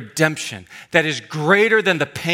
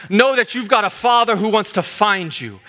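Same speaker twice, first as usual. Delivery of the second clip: ordinary talk, nothing marked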